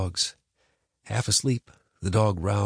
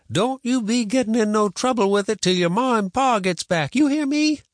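higher, louder stern granny; stern grandma; 13 semitones higher, 4 dB louder than usual. A narrator's reading. Grandma warning.

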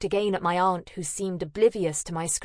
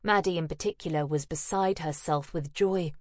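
plain reading voice

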